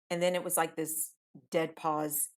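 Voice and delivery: ordinary delivery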